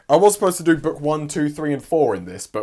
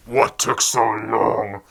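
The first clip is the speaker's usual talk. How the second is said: slow devil voice; 5 semitones lower, much slower than usual. Retold or imitated. Unseen devil voice in building.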